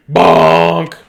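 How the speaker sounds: loud, goofy bonk sound; funny bonk. Shown as a demonstration. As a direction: bop it out like a cartoon bump. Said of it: "Everybody do a funny bonk".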